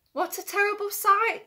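ordinary delivery, nothing marked

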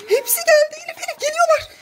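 high, urgent playful panic; panicked hurry. Real, not acted. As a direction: rush her, they are coming. They are coming, hide the sweets. (telaşla, tiz sesle acele ettirerek)

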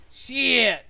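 loud, high burst of frustration; frustrated curse. Real, not acted. Streamer losing the card game, frustrated curse.

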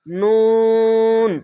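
লাম held long and slow; drawn-out letter name. Shown as a demonstration. Teacher showing students the long letter, drawn out. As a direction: stretch লাম three alif. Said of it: "এখানেও তিন আলিফ টেনে পড়বেন".

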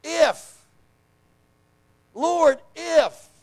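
high, loud cry of Lord if; yelled plea. Retold or imitated. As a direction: shout it out like a desperate man. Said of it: "He yells out".

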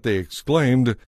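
usual speaking voice

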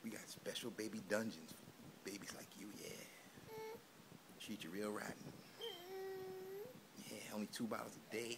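soft hushed villain whisper; mock whisper threat. Whispering pretend baby-jail threats to the baby.

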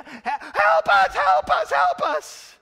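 high, loud desperate pleading; panicked cry for help. Acted. Preacher voicing fleeing soldiers, panicked pleading cry. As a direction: cry out in panic as you run.

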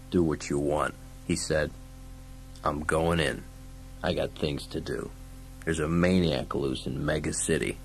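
low, quiet, unhurried tough guy; gruff lawman. Acted. Dredd tells Fergie he's going in, low and grim. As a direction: keep it low and restrained.

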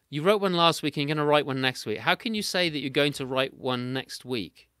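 normal talking voice

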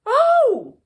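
a bright startled realisation; surprised oh. A narrator's reading. Toad suddenly understanding Frog, surprised.

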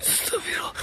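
hushed, voiceless whisper; whispered prayer. Real, not acted. Ghost hunter murmuring istighfar to himself, whispered. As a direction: whisper it under your breath.

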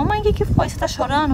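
high-pitched imitated child, easing down; Ricardo. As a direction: voice a schoolboy asking his mother. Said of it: "O Ricardo às vezes chegava da escola".

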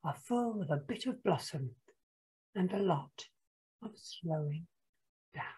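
very soft, slowing, sighing ending; hushed storybook close, spaced out; sighed. A narrator's reading. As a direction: let the words wind down gently. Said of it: "sighed Tisha".

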